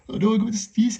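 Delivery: normal talking voice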